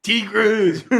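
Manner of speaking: put-on high alien voice; mock alien voice. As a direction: squeal it like a cartoon extraterrestrial, laughing. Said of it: "if they sound like that".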